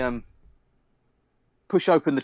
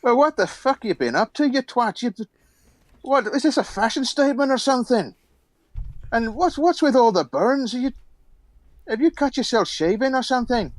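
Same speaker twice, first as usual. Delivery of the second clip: higher, mocking Scottish dwarf voice; mocking old dwarf; 8 semitones higher than usual. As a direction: scold the ugly bird with glee. Dwarf mocks the bird man.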